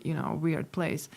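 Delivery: ordinary talk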